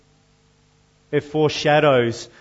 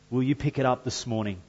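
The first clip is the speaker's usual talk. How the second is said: normal talking voice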